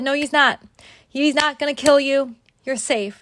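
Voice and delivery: high, forceful, authoritative reassurance; loud. Retold or imitated. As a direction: cut through the panic, loudly insisting.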